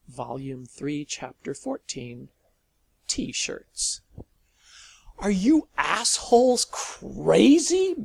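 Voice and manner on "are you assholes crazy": loud, high furious rant at strangers. A narrator's reading. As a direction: blow up at them, much higher and louder. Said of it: "Malcolm ranted at the strangers".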